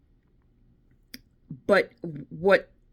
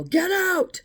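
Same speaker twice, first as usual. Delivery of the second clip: high, drawn-out imitation of a yell; high-pitched retold yell; mock yell; 14 semitones higher than usual. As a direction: call it out like a ghostly voice, much higher. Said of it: "she heard a voice yell".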